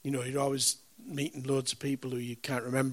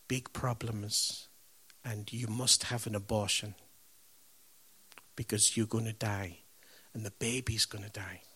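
grave hush; 4 semitones lower, 6 dB quieter than usual. Retold or imitated. Doctor's grim warning retold.

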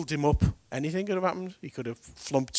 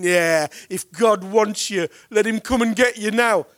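loud, high, slow sneering jeer; mocking taunt; 7 semitones higher, 8 dB louder than usual. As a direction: taunt the dying man. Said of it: "They're all mocking, laughing".

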